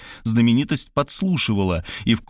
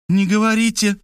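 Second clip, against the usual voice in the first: high, emphatic order; commanding; 11 semitones higher, 3 dB louder than usual. A narrator's reading. Maria is about to speak and Markhalyova forbids it, commanding. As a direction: order them to stay silent. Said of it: "приказала Мархалёва".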